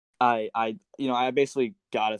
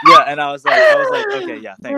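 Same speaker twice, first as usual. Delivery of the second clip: laughing; 14 dB louder than usual. Real, not acted. Laughing retelling of reply.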